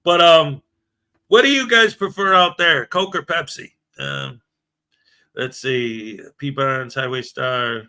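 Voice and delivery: ordinary talk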